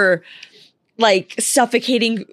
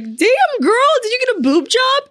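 much higher, squealing hype at her own reflection; mock-amazed compliment; 14 semitones higher than usual. After the shower, hyping up her own reflection, squealing. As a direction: playfully gasp it like a shocked friend.

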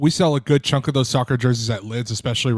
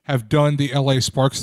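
ordinary talk

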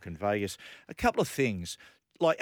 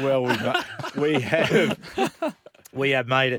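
laughing reply; 4 semitones higher, 8 dB louder, slower than usual. Retold or imitated. Laughing retelling his reply.

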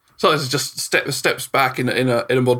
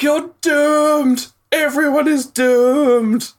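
high, loud, slow wail; doomsaying gnome; 14 semitones higher, 4 dB louder, much slower than usual. Acted. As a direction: wail the doom, dragging it out. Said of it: "And it's like".

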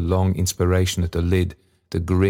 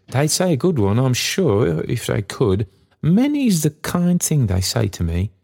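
higher, fond cockney character voice; earnest Trotty; 7 semitones higher than usual. A narrator's reading. Father telling daughter of kindly bells, fond earnestness. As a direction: insist fondly on their kindness.

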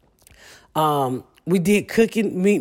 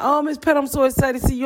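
high, gushing mock fan voice; 8 semitones higher, faster than usual. Fans greeting Miss Pat on Instagram Live, high gushing mock excitement. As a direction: squeal it like a starstruck fan. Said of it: "when they come online".

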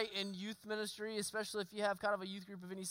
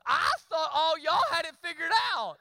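mock naive; 7 semitones higher, 8 dB louder than usual. Real, not acted.

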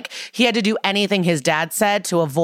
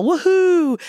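high, loud woohoo; hammed-up celebratory whoop; mock cheer; 12 semitones higher, 4 dB louder than usual. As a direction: whoop it like a giddy fan. Carisi cheering in hallway.

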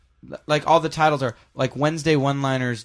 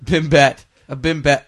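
ordinary talk, nothing marked

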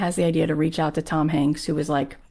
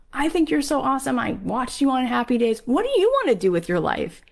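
higher-pitched, eager fan gushing; excited; 7 semitones higher than usual. Retold or imitated. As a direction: act out admiring, curious enthusiasm. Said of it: "I'm so excited that you're here".

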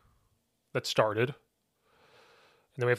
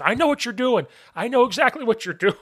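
high, insistent mock-indignant accusation; accusing ref; 10 semitones higher, 4 dB louder than usual. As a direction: imitate an official who has caught you out. Retold ref accusing coach.

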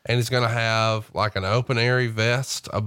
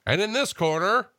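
a hint of ring announcer; 9 semitones higher than usual. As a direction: announce it to the arena. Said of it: "did his best announcer voice".

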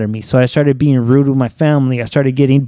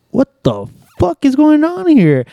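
much higher, slow, stretched-out disbelief; exaggerated disbelief; 12 semitones higher, slower than usual. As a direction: ham up the total bafflement. Retold disbelief, second time.

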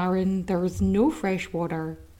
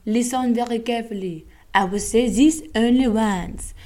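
hammed-up French officer voice, a touch louder; mock French accent; 3 dB louder than usual. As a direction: play a comic French sailor laying down the law. French navy officer quoted, comic.

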